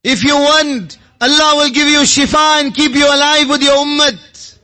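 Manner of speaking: normal talking voice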